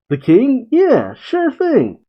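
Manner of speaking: lightly voiced cheerful bunny girl; breezy girl. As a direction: agree without thinking. Voicing Carrot's breezy agreement.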